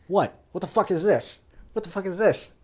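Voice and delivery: indignant retold shouting; angry dude. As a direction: imitate an angry teammate going off, loud and high. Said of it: "an angry dude in general".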